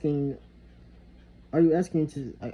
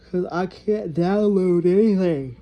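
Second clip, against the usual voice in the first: loud, slow, fed-up complaint; frustrated rant; 5 semitones higher, 6 dB louder, slower than usual. Real, not acted. Venting to viewers about a useless Mac, loud and fed up. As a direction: spit out the frustration, drawn out.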